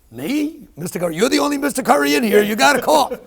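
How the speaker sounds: high, loud disbelieving retort; incredulous mock protest. Heart patient mocking nurses yelling at him, high incredulous. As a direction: throw their words back in mock outrage.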